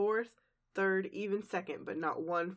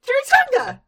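very high, loud squealing chimichanga; laughing squeal; 15 dB louder than usual. Retold or imitated. Co-host answering the Pinkie chant with a laughing squeal. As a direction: shriek it out through laughter. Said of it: "I love it when Pinkie's a real clown".

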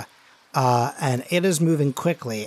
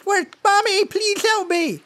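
very high-pitched mock scream; panicked scream; 3 dB louder than usual. Game master voices A J trapped under hay, squealing scream. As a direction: shriek for help in a squealed falsetto. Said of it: "A J screams".

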